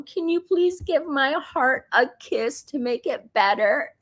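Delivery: put-on high child voice, laughing through it; imitating her toddler. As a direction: plead sweetly like a toddler, half laughing. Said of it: "you'll go".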